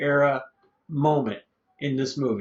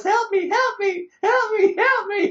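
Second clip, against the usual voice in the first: very high-pitched mock cries for help; screaming damsel in distress; 3 dB louder than usual. Podcaster imitating the film's tied-up heroine, mock high screaming. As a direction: squeal it like a silent-movie damsel. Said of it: "she's screaming like the damsel in distress".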